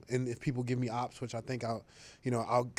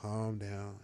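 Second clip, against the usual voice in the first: mock calm Rodgers; 4 semitones lower, 7 dB quieter than usual. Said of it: "he's like".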